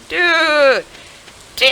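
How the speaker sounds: high, loud repeated dude; loud high alarm. Retold or imitated. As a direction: call out in alarm. Reacting to knife news.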